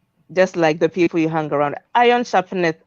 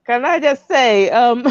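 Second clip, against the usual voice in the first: laughing; 5 semitones higher, 3 dB louder than usual. Real, not acted. Laughing while speaking.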